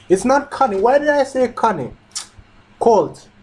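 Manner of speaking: high-pitched, annoyed at your own slip; exasperated self-correction. Real, not acted. Reader correcting own wrong name, exasperated and high. Catches own mistake in reading.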